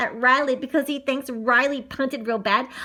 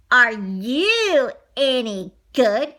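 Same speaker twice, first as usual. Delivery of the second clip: slow, drawn-out challenge; smirking challenge; 4 dB louder, much slower than usual. A narrator's reading. Neighborhood kid sizing up Riley, smirking challenge. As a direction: size up the new kid with a smirk. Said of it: "with a big smirk on his face".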